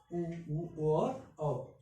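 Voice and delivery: "उ, उ, ओ और अ" drawn out very slowly, each vowel held; slow, held vowel sounds for practice; slow vowel demonstration. Shown as a demonstration. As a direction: stretch each vowel long so students can copy it. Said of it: "पश्च भाग का प्रयोग किया".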